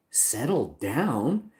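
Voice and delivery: drawn-out, questioning disbelief; indignant echo. Retold or imitated. Streamer repeats support's settle down request, indignant. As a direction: stretch it out, incredulous at being told. Reacts to being told to settle down.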